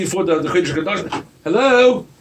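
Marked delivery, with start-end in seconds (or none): 1.4-2.1 high, loud, disbelieving hello; incredulous hello. Real, not acted. Preacher challenging his congregation, loud and incredulous. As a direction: call out in mock disbelief.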